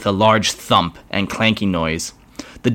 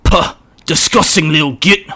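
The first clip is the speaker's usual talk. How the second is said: higher growling orc spitting the words; orc spitting insult; 7 semitones higher, 4 dB louder than usual. Acted. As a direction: spit it out in disgust. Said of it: "Orc spat".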